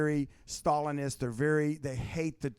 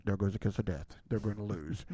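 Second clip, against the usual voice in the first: lower, mock-solemn voice of a doomed candidate; a hint of a gloomy announcer, lowered; 8 semitones lower than usual. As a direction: intone it like a mock death knell. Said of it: "be like".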